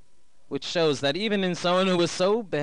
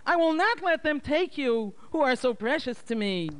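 desperate mother; 13 semitones higher than usual. A narrator's reading. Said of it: "she called out in a loud voice".

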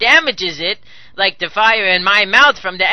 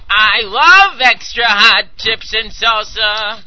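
ordinary talk

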